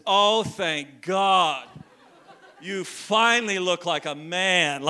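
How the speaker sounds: loud, drawn-out Maine grandmother, thrilled; grandmother's Maine accent. Retold or imitated. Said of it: "she has this Maine accent".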